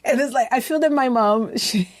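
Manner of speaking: ordinary talk, nothing marked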